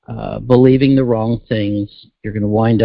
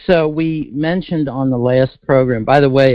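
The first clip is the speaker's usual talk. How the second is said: normal talking voice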